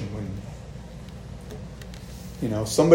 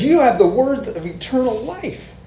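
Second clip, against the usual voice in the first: awed Peter; 10 semitones higher than usual. Retold or imitated. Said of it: "He says".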